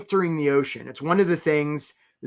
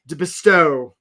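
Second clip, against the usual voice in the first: normal talking voice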